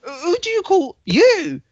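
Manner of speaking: high, put-on voice for a quick back-and-forth; squeaky caricature of the argument; young lad and the man, mocked in a high voice; mock exchange.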